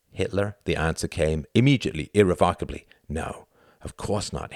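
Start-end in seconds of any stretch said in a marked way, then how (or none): none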